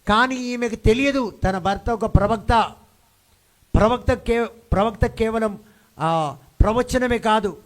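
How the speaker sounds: loud, high, drawn-out preacher's delivery; full-force preaching. Real, not acted. Preacher revealing the husband was a prophet, full-force preaching. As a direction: drive each phrase out to the congregation.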